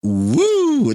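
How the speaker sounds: high, loud woo; excited woo. Pretend. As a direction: let out a hot woo. Wiping sweat over [name]'s mom.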